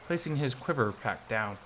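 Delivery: ordinary delivery, nothing marked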